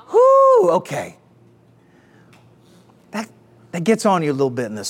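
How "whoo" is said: high, loud "whoo"; sudden soaring preacher's whoop; excited whoop. Real, not acted. Preacher whoops after rousing challenge.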